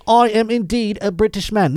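high, hammed-up British accent; shitty British accent. Imitating an American fugitive posing as British, shitty British accent. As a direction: put on a bad British accent. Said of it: "He's just doing a shitty accent".

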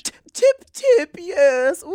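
high-pitched, hammed-up British tittering; cartoonishly prim British voice; mock British accent. Shown as a demonstration. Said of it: "That's my British accent".

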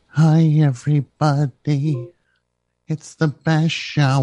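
slow, hushed mock podcast intro; slow mock-measured Hi, everybody; mock timid podcaster. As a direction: lightly parody a polite podcast host. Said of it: "We're not talking like that no more".

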